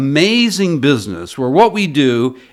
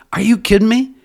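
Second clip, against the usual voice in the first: mock disbelief in a higher voice; incredulous; 8 semitones higher than usual. Guest recalling the porter on the cab ride, incredulous. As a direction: replay the amazement, pitch lifted. Recalls his amazed thought again.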